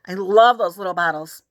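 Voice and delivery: ordinary delivery, nothing marked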